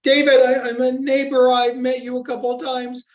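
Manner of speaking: high, tearful plea, voice lifted; tearful girl. Retold or imitated. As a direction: imitate a young girl close to tears. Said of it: "in tears".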